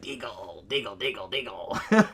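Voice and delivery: low muttering creature repeating the name; mock gremlin chant. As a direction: growl it like a little monster. Gremlin reads sign.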